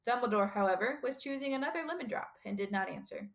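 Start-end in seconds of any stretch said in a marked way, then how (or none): none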